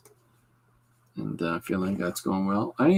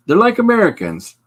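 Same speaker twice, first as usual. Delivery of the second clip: high and loud mock read of the comment; hammed-up high voice for the chat line; reading chat mockingly; 5 semitones higher, 8 dB louder than usual. Streamer reads Frank's chat comment in a high, loud mock voice. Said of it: "Frank sums it up best".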